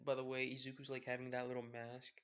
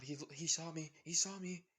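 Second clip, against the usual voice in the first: panicked; 5 semitones higher, 7 dB louder than usual. Acted. Stranger panics while running.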